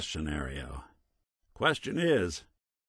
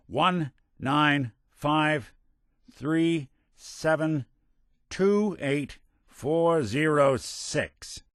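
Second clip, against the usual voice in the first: slow, spaced-out digits, pitched high; reading out code; 5 semitones higher, much slower than usual. Acted. Detective reading decoded numbers aloud, slow and deliberate. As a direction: read each digit separately, very slowly.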